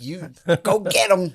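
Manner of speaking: high, put-on cheerleader urging; mock encouragement. Comic imitates the lady at the next table, mock encouragement. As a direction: imitate an over-supportive stranger. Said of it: "trying to encourage me".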